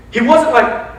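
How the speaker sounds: ordinary talk, nothing marked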